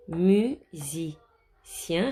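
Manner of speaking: "Musicien" read very slowly, syllable by syllable. Shown as a demonstration. Teacher reading a word very slowly for the children. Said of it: "teacher reads a word very slowly".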